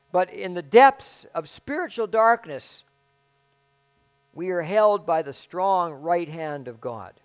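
slow solemn reading voice; formal reading. Acted. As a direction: read the passage with weight. Reads a passage aloud.

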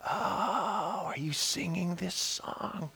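very soft, wondering question; hushed awe. Acted. Preacher replying to God's whisper, hushed awe. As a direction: gasp it softly in wonder.

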